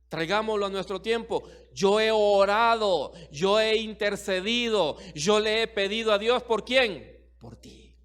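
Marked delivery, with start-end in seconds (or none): 1.7-7.3 loud, fervent preaching, slowed on each claim; preaching at full force. Acted. Preacher to his congregation, in full fervent preaching. Preacher voicing Jesus' prayer. 7.4-7.9 breathy whispered aside. Acted. Preacher telling each listener it is for them, whispered.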